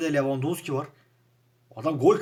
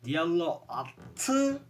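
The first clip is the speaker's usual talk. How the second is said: stunned; 5 semitones higher, much slower than usual. Real, not acted. Stunned at goal.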